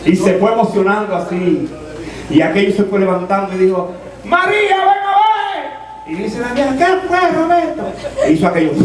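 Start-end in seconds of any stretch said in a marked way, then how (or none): 4.2-6.1 high, very slow excited call to his wife; excited old man. Retold or imitated. 6.8-7.9 high, startled wife; old wife voice. Retold or imitated.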